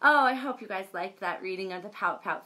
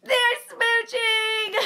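high and louder, slow, through giggles; laughing; 7 dB louder, slower than usual. Real, not acted. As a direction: giggle it out with delight. Giggling at the kissing.

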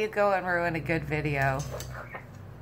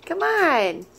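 high, loud sing-song pet talk; teaching a parrot; 13 semitones higher, 3 dB louder than usual. Real, not acted. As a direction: coax the bird up high and bright.

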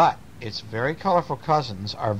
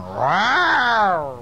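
high playful roar; drawn-out mock growl-roar; baby bear roar; 9 semitones higher, 4 dB louder than usual. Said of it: "And Baby Bear is going".

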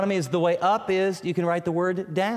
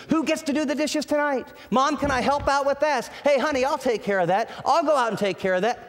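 a lightly voiced, higher, keen helper at home; bright volunteering voice, higher; eager family member; 7 semitones higher than usual. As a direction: play a family member jumping to help with chores. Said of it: "what would happen in your house".

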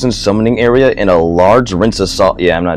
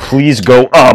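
raised voice, punched word by word; loud plea; 3 semitones higher, 7 dB louder, slower than usual. Real, not acted. Host begging it to go up, loudly. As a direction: plead out loud, insistently. Same plea repeated much louder.

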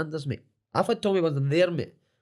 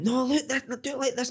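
higher, bossy coach voice showing how; imitating the coach; 11 semitones higher than usual. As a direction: mimic a pushy coach demonstrating. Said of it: "he was one of them".

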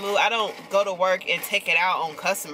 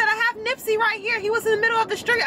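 much higher, polite stranger on the phone; caller's voice; 14 semitones higher, faster than usual. Retold or imitated. Retelling the stranger who called about her dog, high polite voice. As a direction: lift way up into the caller's sweet phone voice.

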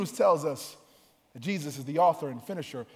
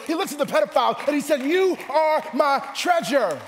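rhythmic, raised list building to a shout; full-force preaching; 7 semitones higher, 7 dB louder than usual. Real, not acted. Preacher assuring every kind of sinner they are treasured, full-force preaching. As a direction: hammer each repetition, then stretch out the refrain.

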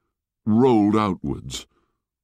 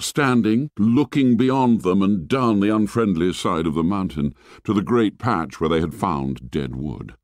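ordinary delivery, nothing marked

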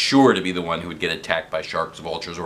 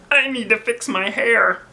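high, fussy fretting; fussy nurse voice; 4 dB louder than usual. A narrator's reading. Nurse fretting over her hair, high fussy voice. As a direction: fret about her hair.

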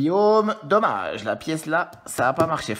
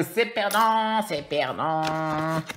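drawn-out loss; much slower than usual. Real, not acted. Losing ticket, drawn out.

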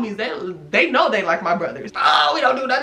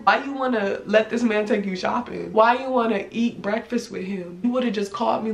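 ordinary talk, nothing marked

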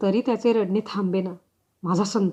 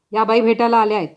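calling out; 6 dB louder, faster than usual. Acted. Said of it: "तिला परत आवाज दिला".

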